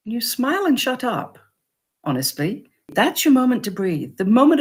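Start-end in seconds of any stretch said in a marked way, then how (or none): none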